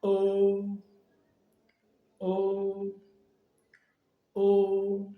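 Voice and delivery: "O" held long on a level pitch; first tone. Shown as a demonstration. Teacher leads learners through vowel O, "O" long and level. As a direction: sustain each "O" without rise or fall. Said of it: "avec la voyelle O".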